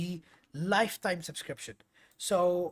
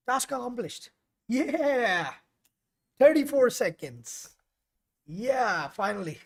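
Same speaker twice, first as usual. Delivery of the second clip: higher and louder, laughing with real delight; excited cheer; 7 semitones higher, 9 dB louder than usual. Real, not acted. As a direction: celebrate the finally-finished task out loud. Drone mission finally succeeds.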